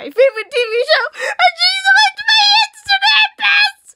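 very high, loud squealing screams; freaking out. Real, not acted. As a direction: shriek like a starstruck fan. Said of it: "I'm, like, freaking out right now".

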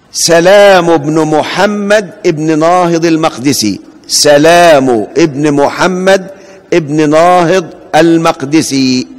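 سلام said slowly with a single light L; slow careful spelling of the name, short lam in سلام; slow dictation. Shown as a demonstration. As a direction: read سلام بن محمد out slowly, lam unstressed. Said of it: "تنطق فيه اللام مخففة".

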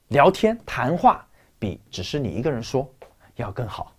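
ordinary delivery

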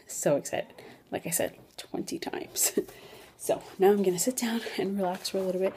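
hushed whisper. Real, not acted. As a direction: whisper it softly. Quiet aside, baby sleeping.

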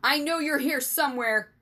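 enraged vice principal calling into the dark; angry bellow. A narrator's reading. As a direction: bellow at hidden pranksters. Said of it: "the vice principal bellowed into the night".

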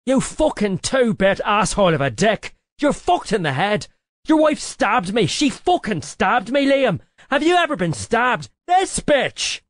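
high, loud furious rant; furious shout. Acted. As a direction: scream it in fury at your boss. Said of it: "He was pissed".

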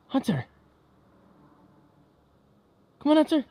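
calling the dog. Real, not acted. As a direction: shout for the dog to come back. Calling the dog outside at night.

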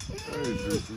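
very high squeaky hello; squeaky pet greeting. Real, not acted. Owner greeting Juicy, very high squeak. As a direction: squeak the dog's name.

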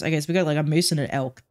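usual speaking voice

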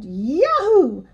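high, moderate storybook yahoo; yelling cowboy. A narrator's reading. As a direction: whoop it like a triumphant rider, restrained. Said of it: "up into the air and yelled".

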